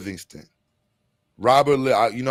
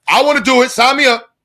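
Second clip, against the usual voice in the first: loud, high, mock-excited yell; eager volunteer; 10 semitones higher, 9 dB louder, faster than usual. Volunteer jumping at the offer of land, mock-eager shout. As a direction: shout it like an overeager recruit.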